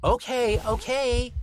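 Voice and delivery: put-on high exasperated boy; child voice. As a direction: give in like an annoyed kid. Lucy relenting.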